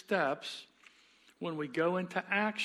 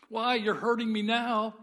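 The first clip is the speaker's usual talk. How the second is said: higher and louder cry of hurt; pained ghost; 5 semitones higher, 5 dB louder than usual. Acted. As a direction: protest at the burning touch. Ghost in pain.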